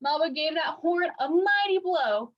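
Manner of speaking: ordinary delivery, nothing marked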